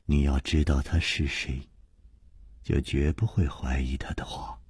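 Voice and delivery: low, hushed assurance; quiet, grave and low; subdued Liu Wumei. A narrator's reading. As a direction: say it softly, fading to a whisper. Narrator voices Liu Wumei.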